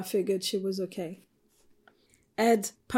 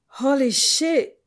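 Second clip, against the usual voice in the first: shocked; 5 semitones higher, 6 dB louder, slower than usual. Acted. Shocked at hormone news.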